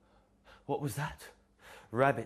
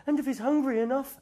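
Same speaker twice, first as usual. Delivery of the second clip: very high, loud little mouse voice; squeaky mouse; 6 dB louder than usual. Acted. Mouse adding a worry to Rabbit's, squeaky and high. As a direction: pipe it up like a tiny mouse.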